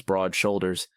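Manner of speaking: plain reading voice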